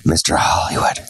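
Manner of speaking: breathy sexy whisper. Acted. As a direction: purr the nickname seductively. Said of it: "I even said it in a sexy voice".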